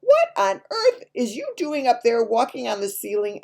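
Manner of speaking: high, loud indignant yell; big sister's yell. A narrator's reading. Big sister catching sibling on the ceiling, yelling.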